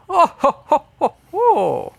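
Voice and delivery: high, theatrical villainess laugh; mysterious woman's laugh. Acted. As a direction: put on a high, mocking "oh-ho-ho" laugh. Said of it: "another laughing voice. This is the voice of a woman".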